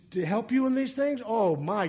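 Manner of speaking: ordinary delivery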